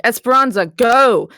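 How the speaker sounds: loud, high command to act now; urgent shout. Acted. Homily orders Esperanza to heal, loud urgent shout. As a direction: yell the order across the room. Healer barks order in emergency.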